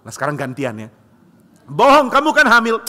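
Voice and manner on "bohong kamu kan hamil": accusing. Acted. Cronus accuses his wife.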